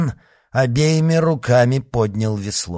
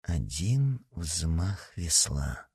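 slow, much softer, low; quiet suggestion; 4 semitones lower, 8 dB quieter, much slower than usual. A narrator's reading. Aramis murmuring to Porthos, slow and soft. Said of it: "добавил он".

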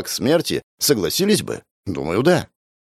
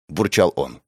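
ordinary delivery

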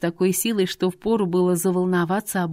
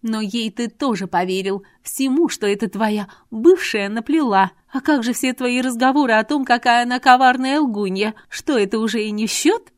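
higher, louder woman's voice, accusing; indignant Irina; 4 semitones higher, 6 dB louder than usual. Acted. Irina throws Artur's old words at him, indignant. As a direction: push the reproach, pitched up.

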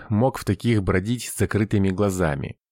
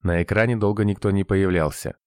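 usual speaking voice

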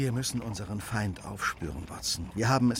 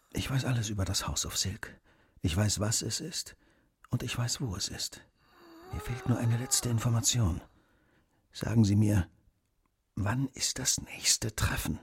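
quiet deathbed questioning; 2 semitones lower, 4 dB quieter than usual. Acted. Detective asking the dying man about the next meeting, hushed. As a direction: press him softly, slowing on the final question.

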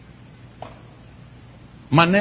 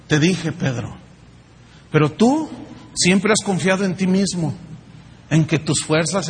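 tender voice of Jesus; 4 semitones lower than usual. Acted. Voz de Jesús mirando a Pedro. (Jesús, voz baja y tierna)

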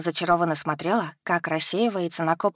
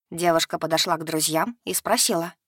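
plain reading voice

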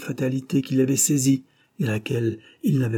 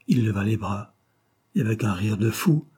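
usual speaking voice

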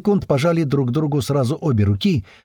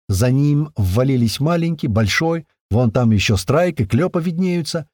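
ordinary delivery

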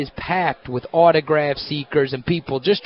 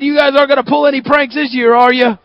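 teasing guard; 10 semitones higher, 3 dB louder, faster than usual. Retold or imitated. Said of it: "he said".

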